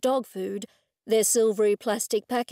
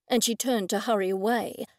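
ordinary delivery, nothing marked

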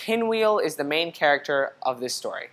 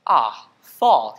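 lower, savoring ah fall; contented sigh; 5 semitones lower, 4 dB louder than usual. A narrator's reading. Owl looking at the leaves, content. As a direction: savor the season.

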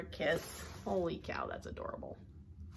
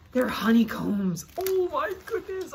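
slow, loud delight rising high; thrilled; 7 semitones higher, 7 dB louder, slower than usual. Real, not acted. Collector discovering honeycomb ornaments, thrilled exclamation. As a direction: exclaim slowly with mounting delight.

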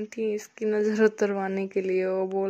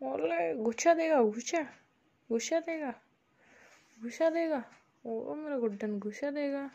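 higher, softer sing-song cooing; 4 semitones higher, 6 dB quieter than usual. Real, not acted. Cooing to the baby. (धीमी ऊँची आवाज़ में बच्चे से लाड़)

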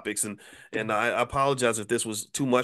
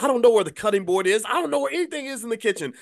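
high flustered panic; 9 semitones higher, 4 dB louder, faster than usual. Retold or imitated. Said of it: "I'm like".

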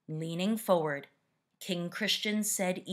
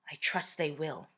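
ordinary delivery

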